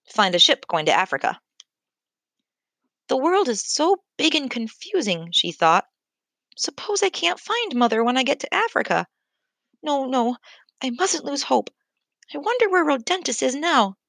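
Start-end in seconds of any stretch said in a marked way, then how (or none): 3.1-5.3 put-on a small anxious girl wondering aloud; worried young giraffe. A narrator's reading. Giraffe calf to herself, lost and worried. 6.6-14.0 put-on a small anxious girl wondering aloud; worried young giraffe. A narrator's reading. Giraffe calf to herself, lost and worried. Ruva worrying about Mother.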